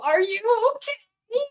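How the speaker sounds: high, slow, strained call of concern; high-pitched mock worried call; father-in-law calling out. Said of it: "all I hear is my father-in-law go".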